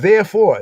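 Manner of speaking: "Therefore" punched high and loud, falling; stressed Therefore. Shown as a demonstration. Preacher hammering "Therefore" to the daughters, high and loud. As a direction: land "Therefore" high with a hard fall. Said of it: "you gotta check out that word".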